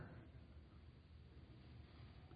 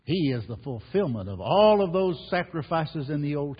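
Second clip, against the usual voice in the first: louder, driving the point home; preaching at full force; 4 semitones higher, 6 dB louder than usual. Acted. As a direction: lift the congregation. Preacher rising to a climax.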